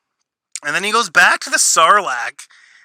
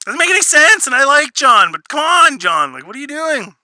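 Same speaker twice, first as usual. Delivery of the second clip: much higher, louder, fed-up protest; exasperated; 10 semitones higher, 5 dB louder than usual. Real, not acted. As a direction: throw up your hands at the writer. Exasperated rant at the writer.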